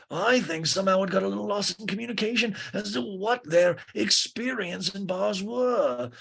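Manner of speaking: high, stretched put-on voice; Lisa. Lisa tells Greg about lost communication, high imitation. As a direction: imitate her lofty lilt, drawing out the end.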